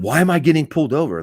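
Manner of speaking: high, fast mock complaint; berating driver. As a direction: imitate an indignant driver berating a cop. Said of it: "don't start berating him".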